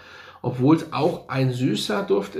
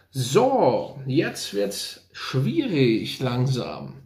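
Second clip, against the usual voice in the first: slow musing; much slower than usual. Real, not acted. Weighing the next ranking.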